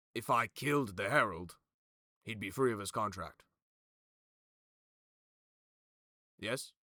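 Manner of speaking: emphatic schemer testing a loophole; Magin. Acted. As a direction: propose it boldly, then seek agreement. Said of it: "you watch Magin go".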